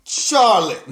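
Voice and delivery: loud, halting mic work; imitating a stiff, stumbling promo; mock wrestler promo. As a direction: stall loudly, then call out the rival. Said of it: "watch her on the mic".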